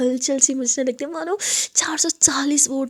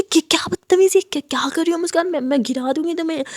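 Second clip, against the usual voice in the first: louder, higher, fast scolding; scolding outburst; 5 semitones higher, 5 dB louder than usual. Acted. Aadya scolding tipsy Muskan pulling her collar, loud. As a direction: snap at her in alarm. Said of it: "आद्या बोलती".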